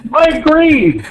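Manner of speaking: real exasperated high-pitched yelling, laughing in disbelief; crashing out. Real, not acted. Caller ranting to host about the coaching, exasperated yelling. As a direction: rant at the top of your voice, half laughing, half crying. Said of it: "never seen Cam crash out like that".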